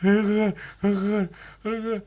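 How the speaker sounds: high mock whimper, repeated; whimpering shark. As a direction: moan like a traumatised shark. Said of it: "the shark's there going".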